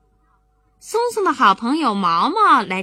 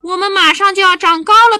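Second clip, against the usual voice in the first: loud eager boast; 9 semitones higher, 10 dB louder than usual. Acted. Louder to mother.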